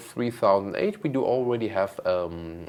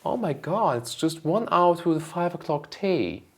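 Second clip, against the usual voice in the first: playful put-on British accent, a bit higher; mock British accent; 7 semitones higher, 3 dB louder than usual. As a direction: camp up a British teatime accent. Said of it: "a British accent for an episode".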